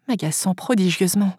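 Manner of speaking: plain reading voice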